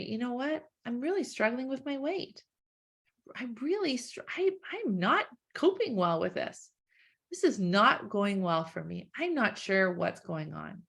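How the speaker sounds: quiet, candid self-talk; honest admission. Acted. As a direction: admit it gently to yourself.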